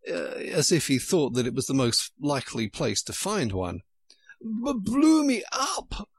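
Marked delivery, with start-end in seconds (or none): 4.4-6.1 high, slow, out of breath; panting queen. A narrator's reading. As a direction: gasp it, out of breath. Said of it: "panted the queen".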